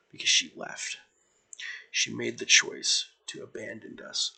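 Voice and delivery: soft, heavy-hearted confession; a weary leader admitting she misses her friend; grieving team leader. Acted. Antiope confides her grief.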